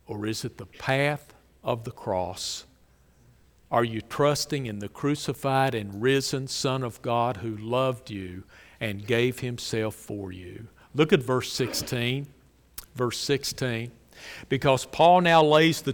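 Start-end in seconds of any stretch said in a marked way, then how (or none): none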